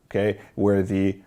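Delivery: usual speaking voice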